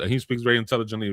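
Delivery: ordinary talk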